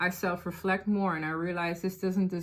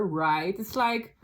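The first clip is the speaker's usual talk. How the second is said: usual speaking voice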